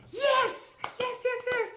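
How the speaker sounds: very high, loud yes cheer; jubilant cheer. Retold or imitated. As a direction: celebrate the escape. Lizard escapes snakes.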